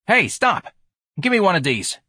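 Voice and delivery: high, loud hail; young tough flagging down the vendor loudly; calling out. A narrator's reading. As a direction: stop the cart with a shout. Boy hails the vendor.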